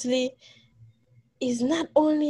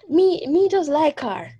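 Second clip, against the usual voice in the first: slow, light Jamaican imitation of her father; a hint of her dad's Jamaican voice, drawn out; lightly voiced Jamaican father, unhurried; father's Jamaican patois; 4 semitones higher than usual. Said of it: "It's like him just".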